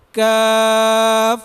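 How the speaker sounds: کاف said light, held, without rounding the mouth; thin letter. Shown as a demonstration. Teacher modelling for the students, a light کاف. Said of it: "یہ ادا ہو گیا".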